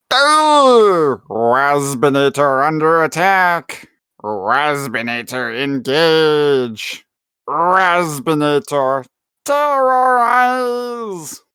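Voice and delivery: high, slow, whiny robot wasp voice; drawn-out, squeaky insect robot; Waspinator. Acted. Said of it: "Christopher does a better giant wasp voice".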